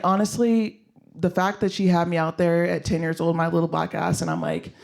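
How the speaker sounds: ordinary talk, nothing marked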